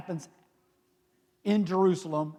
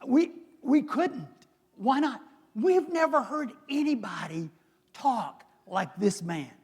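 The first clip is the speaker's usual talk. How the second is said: higher, hushed amazed guards; awed guards; 5 semitones higher than usual. Retold or imitated. Guards reporting back to priests, high and awed. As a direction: marvel like you can't explain it. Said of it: "They're like".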